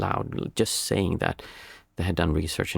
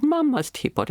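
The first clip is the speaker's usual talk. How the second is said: much higher, imitating a sad little boy; crying toddler; 3 dB louder than usual. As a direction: wail it like an upset two-year-old. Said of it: "He said".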